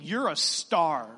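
ordinary talk, nothing marked

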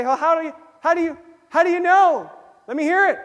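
loud, high doubting voice; a doubter loudly challenging the believer; challenging skeptic. Retold or imitated. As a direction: demand proof, pushing hard. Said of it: "You say".

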